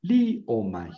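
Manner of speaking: "Li o mai" in separate syllables, accent on Li; stress on LI. Shown as a demonstration. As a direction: hold "Li", then let "o mai" fall. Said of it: "Aquí pongo el acento".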